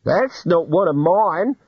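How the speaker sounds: slow, high-pitched denial; puzzled flock owner. A narrator's reading. Flock owner sees strange ram.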